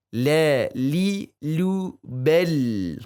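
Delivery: "La, li, lu, bel" with a light, thin l, never heavy; light lam chant for students; thin lam drill. Shown as a demonstration. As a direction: keep every "la" thin and clear. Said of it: "Harus tipis".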